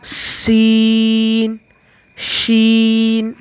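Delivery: slow contrast of सीन and शीन; contrasting sibilants. Shown as a demonstration. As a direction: keep सीन and शीन clearly apart, slowly. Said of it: "ये दो में difference रखना है".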